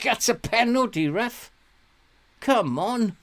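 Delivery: high, loud penalty appeal; pleading with the referee. Real, not acted. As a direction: beg the ref for the call.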